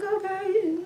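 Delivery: high, soft, wary aside; doubtful okay. Retold or imitated. Viewer reacts to saving humanity in Oklahoma, softly doubtful. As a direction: back away quietly on a high note.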